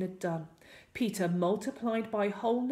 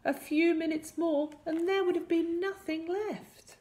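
high, lightly acted tearful relief; sobbing gardener; 10 semitones higher than usual. A narrator's reading. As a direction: voice a mild storybook sob, pitched up. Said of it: "the gardener sobbed".

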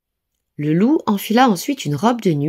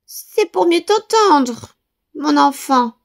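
wolf as grandma; 9 semitones higher, 3 dB louder, slower than usual. A narrator's reading.

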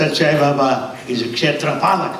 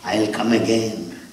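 low, soft consoling promise, lightly voiced; a hint of a comforting Jesus; tender reassurance; 9 semitones lower, 3 dB quieter than usual. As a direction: hush them gently, promising to return. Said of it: "Because he said".

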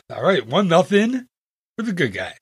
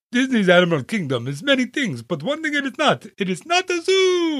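hammed-up African-accented commercial voice, climbing much higher; mock African accent; 9 semitones higher than usual. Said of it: "the crazy African, uh, accent".

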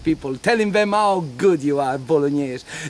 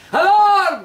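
high, loud cry of alarm; alarm shout; 13 semitones higher, 5 dB louder than usual. Acted. Jester as townsman warning Bologna, loud alarm cry. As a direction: raise the alarm like a town crier.

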